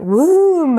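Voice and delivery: long swooping sound-effect "womb"; imitated whoom. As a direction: stretch the word into a high whoosh. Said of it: "In my head it went womb".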